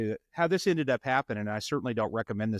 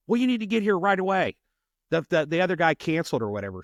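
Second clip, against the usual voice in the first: urgent boss order; 6 semitones higher, 3 dB louder than usual. Retold or imitated. Boss orders him in immediately.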